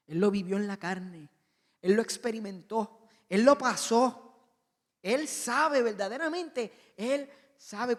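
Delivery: loud, slow insistent preaching; building sermon climax. Acted. Preacher assuring the congregation that Jesus knows, loud and building. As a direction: hammer each short phrase harder.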